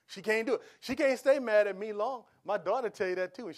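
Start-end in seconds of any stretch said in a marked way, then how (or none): none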